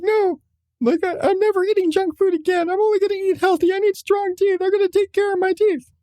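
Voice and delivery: put-on high, eager little-boy voice; earnest kid vow. Mom imitating her son's tearful resolution. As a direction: chirp it like a kid making a big promise. Son voiced vowing healthy eating.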